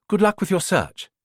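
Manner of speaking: well-bred, polite farewell; posh English accent. Acted. Mother wishing Rita luck, posh English accent. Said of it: "She had a posh English accent".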